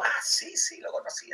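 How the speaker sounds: very high, whispery "oh yes"; mock recognition. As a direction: squeak out the sudden recognition. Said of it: "así".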